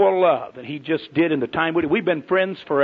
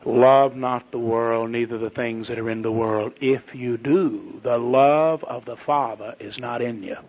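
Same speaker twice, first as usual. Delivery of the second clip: slow, lower, measured recitation of the verse; solemn scripture reading; 6 semitones lower, slower than usual. Acted. Preacher reciting scripture to the congregation, slow solemn intoning. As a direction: intone the verse slowly and deliberately.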